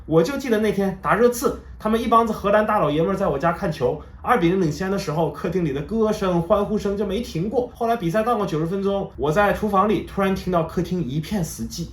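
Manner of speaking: imitating an eager wife, pitched well up; excited retelling. Neighbour's wife finally joins the football chat, excitedly. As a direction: jump in high and keen, acting out her excitement. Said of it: "此时终于兴奋地加入了谈话".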